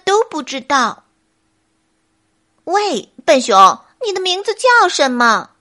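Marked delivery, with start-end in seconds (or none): 2.6-5.5 loud call, then higher question; clever monkey. Acted. Monkey calls to the bear with a bright idea, cleverly. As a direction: call out and ask smartly. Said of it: "小猴很聪明地问".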